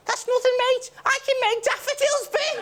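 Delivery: very high-pitched Scouse boast; mock Scouse superhero. Crap Scouse superhero boasting to another hero, very high voice. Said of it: "Poor Scousers ... a really crap superhero".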